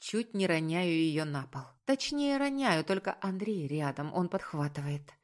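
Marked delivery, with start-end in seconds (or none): none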